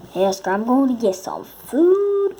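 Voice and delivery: loud call to a friend across the room. Real, not acted. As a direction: shout it over to Oscar. Calling out to a friend.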